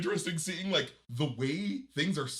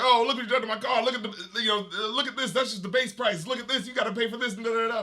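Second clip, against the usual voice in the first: higher, fast mock rant about the car price; Sinbad fast talk; 7 semitones higher than usual. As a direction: rattle it off, hamming up the comic. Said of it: "Talk fast, make funny, joke funny".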